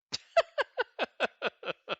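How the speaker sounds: loud, high, rattling laugh; laughing fit. Real, not acted. Streamer cracking up after the accent attempt, loud high laughter.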